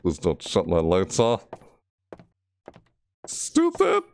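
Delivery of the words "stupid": one high shrieked insult; high angry yelp. Real, not acted. Gamer shrieking at the doll for shutting the lights, high yelp.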